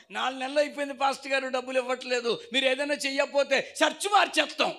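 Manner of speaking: loud, high complaint building to an ultimatum; angry threat. Acted. Angry member threatens the pastor. (కోపంగా, గట్టిగా బెదిరించడం)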